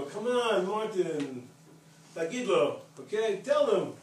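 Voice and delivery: put-on high, punchy group-of-kids urging; imitated egging on. Kids to Martin in front of visitor, egging him on. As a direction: act out friends coaxing a shy mate.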